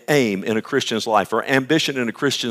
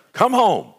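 higher, louder call home; 11 semitones higher, 5 dB louder than usual. Retold or imitated. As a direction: grandmother yelling from the fence. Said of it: "just yell it out".

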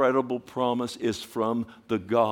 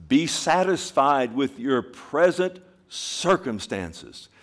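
slow emphatic reading; 4 semitones higher, 6 dB louder, slower than usual. Shown as a demonstration. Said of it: "reading scripture slowly".

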